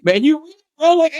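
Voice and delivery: high, stunned disbelief; amazed reaction. Retold or imitated. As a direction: react like it's unbelievable.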